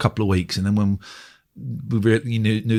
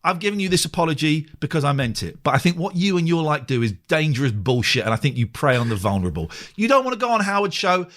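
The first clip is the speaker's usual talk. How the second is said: higher-pitched exasperated telling-off; retold angry retort; 4 semitones higher than usual. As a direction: act out an indignant rant at someone who snubbed you. Re-enacting his angry email rant.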